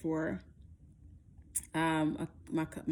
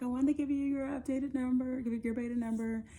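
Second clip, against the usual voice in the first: higher-pitched, understated nurse voice; muted nurse delivery; 6 semitones higher than usual. Acted. Nurse reporting beta result, without celebration. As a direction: imitate a nurse simply passing on a number. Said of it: "her tone and the way she said it".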